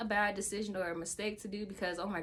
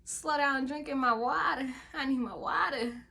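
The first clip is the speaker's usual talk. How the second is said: higher and louder, disbelieving; incredulous outburst; 6 semitones higher, 5 dB louder, slower than usual. Retold or imitated. Patient retorting to nurse who says slow down, incredulous. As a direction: throw the words back at her in disbelief. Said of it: "I was like".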